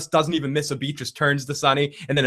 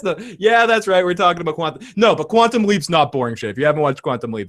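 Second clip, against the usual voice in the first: usual speaking voice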